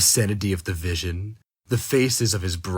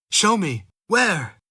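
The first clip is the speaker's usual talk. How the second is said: urgent cry; 12 semitones higher, 5 dB louder than usual. Acted. Cousin cries out.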